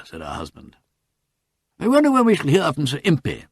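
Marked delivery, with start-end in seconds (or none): 1.8-3.5 higher, louder quick colonel; hurried change of subject. A narrator's reading. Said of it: "said Colonel Marchbanks hurriedly".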